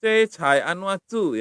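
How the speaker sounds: C Chai Anwatsu with C as a crisp ch sound, slowly; slow careful C. Shown as a demonstration. Teacher drilling a Thai word for students, slow and deliberate. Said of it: "pakai C".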